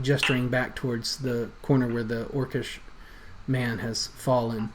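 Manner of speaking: usual speaking voice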